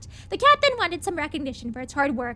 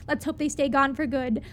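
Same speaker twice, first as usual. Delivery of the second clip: ordinary delivery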